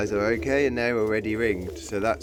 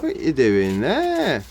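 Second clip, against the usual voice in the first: high, louder, drawn-out cooing; singsong pet voice, pitched up; doting pet greeting; 7 semitones higher, 4 dB louder, slower than usual. Real, not acted. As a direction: greet a pretty bird adoringly. Greeting a pigeon.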